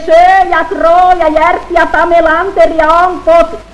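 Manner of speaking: high, loud bawled list; high hollering roll call; loud bawling. Acted. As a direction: bellow every name from the valley.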